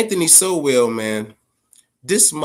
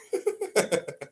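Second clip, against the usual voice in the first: high laugh; 8 dB quieter than usual. Real, not acted. Laughing after game rant.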